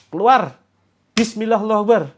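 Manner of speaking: loud, high shouted rebuke; barked command. Shown as a demonstration. Leader shows the shout while patting the sore leg, barked. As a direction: snap the command out hard.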